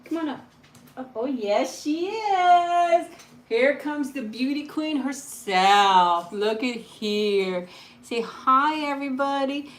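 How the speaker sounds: high, slow, gushing. Real, not acted. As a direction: gush over the dog. Dog arrives, proud owner.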